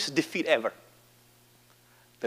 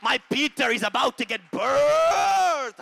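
high, loud shout drawing out "birthed"; climactic preaching shout; 13 semitones higher, 7 dB louder than usual. Real, not acted. Preacher proclaims Peter's birth to congregation, climactic shout. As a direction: belt it out, stretching the last word.

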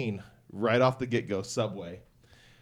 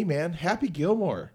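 higher, slow, emphatic excitement; excited; 7 semitones higher, 3 dB louder than usual. Real, not acted. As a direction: gush about a favourite film. Excited about Happy Gilmore.

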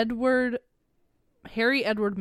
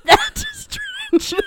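laughing; 8 dB louder, much slower than usual. Retold or imitated. Laughing retelling a retort.

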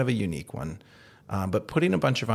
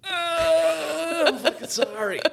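high, laughing, flustered; laughing apology; much slower than usual. Retold or imitated. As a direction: blurt it out through laughter. Retelling apologizing to stranger.

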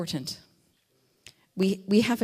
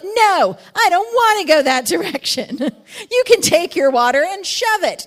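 much higher, loud laughing sheep talking back; rebellious sheep; 10 semitones higher, 10 dB louder than usual. Retold or imitated. Preacher mocks a rebellious sheep, high and laughing. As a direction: play a stroppy sheep refusing, laughing.

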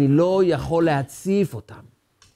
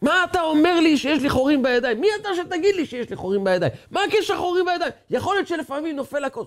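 high, fast outraged yelling; retold angry yelling; 3 dB quieter, faster than usual. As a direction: shout it back as the offended friend. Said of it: "הוא התחיל לצעוק".

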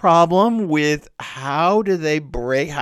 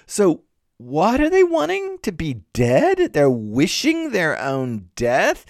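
baffled disbelief; 2 semitones higher than usual. Acted. Reader questioning how the dead can wish for death, incredulous. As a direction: react as a reader who can't believe it. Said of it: "three what moments in which I, as a reader, go, What".

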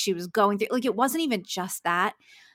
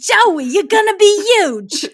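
much higher, much louder, slow and laughing; over-the-top laughing agent voice, pitched way up; Estelle impression; 11 semitones higher, 13 dB louder, slower than usual. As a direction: ham up the gushing agent through laughter. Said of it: "It's Estelle, Joey's agent in France".

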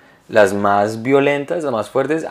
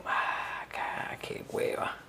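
slow, whispered self-reproach; dismayed inner voice; 16 dB quieter, much slower than usual. Retold or imitated. Husband realising the reason after the fight, whispered dismay. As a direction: whisper it slowly to yourself, deflated.

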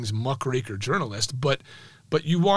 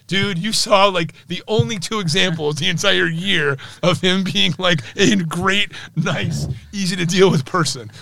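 louder, higher, ribbing disbelief; mock incredulous friends; 4 semitones higher, 6 dB louder than usual. Reporter friends ribbing the writer, louder incredulous mock disbelief. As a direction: razz him like a teasing colleague.

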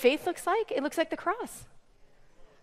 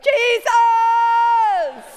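long, high, loud wail; hammed-up desperate shout to heaven; imitated crying out; 9 semitones higher, 9 dB louder than usual. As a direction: cry the name out at full voice, drawn out. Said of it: "You just cry out to him".

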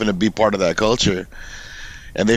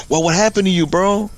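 higher-pitched mock concern; 7 semitones higher than usual. Imagined New Yorker hearing someone is tight, mock concern. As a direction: check on a friend, lightly acted. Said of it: "they're gonna be concerned about you".

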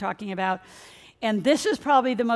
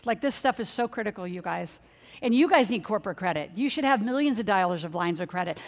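ordinary delivery

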